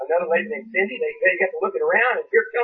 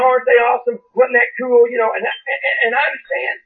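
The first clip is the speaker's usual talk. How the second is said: raised, driving pulpit voice; impassioned preaching; 3 semitones higher, 4 dB louder than usual. Real, not acted. Preacher urging the church to rely on God, impassioned preaching. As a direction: preach it with force, punching key words.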